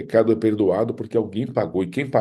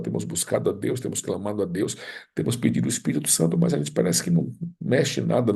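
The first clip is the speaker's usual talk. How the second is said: usual speaking voice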